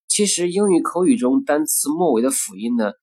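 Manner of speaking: ordinary talk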